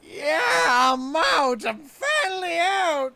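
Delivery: high, loud, slow cries of freedom; shouting for joy, drawn out; joyful escape. Acted. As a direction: burst out crying with relief at being free. Freed from the dragon's belly.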